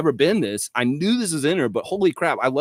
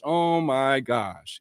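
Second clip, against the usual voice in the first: slow drawn out oh my gosh; much slower than usual. Real, not acted. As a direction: say "Oh my gosh" very slowly. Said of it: "slow exclaim".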